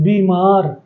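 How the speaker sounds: "बीमार" with a drawn-out ee, then a gap; बड़ी ई का उच्चारण. Shown as a demonstration. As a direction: lengthen "बी" before "मार" in "बीमार". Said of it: "वो थोड़ा सा गैप लिया जाता है". ("बीमार" में बी को लंबा खींचकर)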